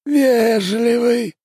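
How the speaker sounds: slow high spirit voice; house spirit. Acted. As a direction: drawl it like an old spirit. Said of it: "пустота чуть понижает тон".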